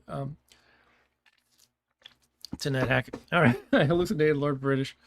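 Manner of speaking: ordinary talk, nothing marked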